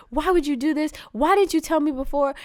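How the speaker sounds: high, heated replay of an angry demand; indignant mock outburst; retold rage. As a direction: demand answers, pitch jumping up. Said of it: "It's just, like, full rage".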